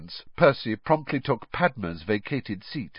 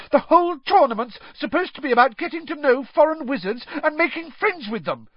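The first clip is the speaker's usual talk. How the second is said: much higher, piercing protest; shrill; 5 dB louder than usual. A narrator's reading. Hermione arguing with Ron at the ball, shrill. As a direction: pitch it up shrill and urgent. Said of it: "said Hermione shrilly".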